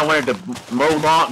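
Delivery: usual speaking voice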